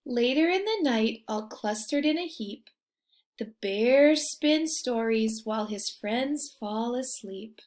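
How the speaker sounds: lively storybook reading; reading to children. A narrator's reading. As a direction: read it like a bedtime picture book. Reading picture book aloud.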